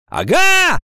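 very high, loud exclamation; triumphant cry. A narrator's reading. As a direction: cry out as if caught proof. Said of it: "закричал". (громкий выкрик)

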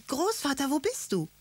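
put-on high, frightened child calling out; scared scream. A narrator's reading. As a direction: cry out in acted panic. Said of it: "schrie Karlchen".

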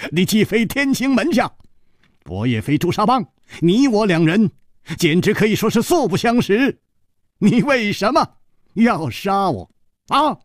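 high, animated, amused questioning; cheerful protest. A narrator's reading. Hero asks his attacker why kill a stranger, high amused protest. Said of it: "反而笑着说".

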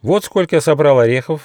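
high, loud little squirrel boast; proud. Acted. Young squirrel shows off his gathered nuts, proud. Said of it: "с гордостью сказал он".

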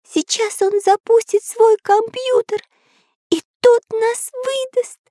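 very high squeaky character voice; испуганный Карандаш. A narrator's reading. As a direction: speak as a tiny, worried figure. Карандаш fears being found. (тоненький испуганный голосок)

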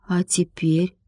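hushed, husky whispered question; slightly hoarse. A narrator's reading. Boy nervously asks the girl about their shared tale, hoarse whisper. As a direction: ask it in a soft, husky near-whisper. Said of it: "Владик сипловато спросил".

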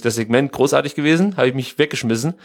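normal talking voice

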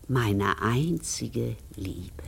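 slow, soft whisper full of love; tender whisper. Acted. Widow reciting her fallen husband's letter: tender whisper. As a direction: let the last words fade into a whisper.

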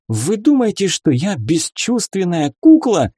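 high, outraged challenge; indignant. A narrator's reading. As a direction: protest hotly like an offended young lady. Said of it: "возмутилась барышня".

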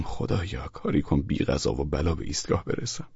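hushed, low private prayer; very soft, low plea to God; praying to oneself. A narrator's reading. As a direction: pray softly under your breath.

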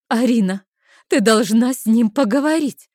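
loud, high urgent demand; sudden outburst. A narrator's reading. Friend suddenly demanding that Arina talk to him, exploding. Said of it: "Внезапно взорвалась она".

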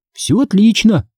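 high, upbeat answer; assuring. A narrator's reading. Daughter reassuring worried mother, assuring. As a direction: brush the worry aside. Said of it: "заверила я".

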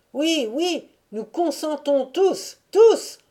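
high assent building to a loud last word; unanimous crowd cry. A narrator's reading. Crowd accepting Kirdiaga as chief, crying out together. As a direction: cry yes as one crowd, peaking at the end. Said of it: "ce cri unanime".

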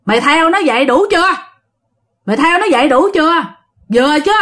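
imitating a master's forceful challenge; stern scolding. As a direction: scold the spirit. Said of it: "ông thầy nạt vậy".

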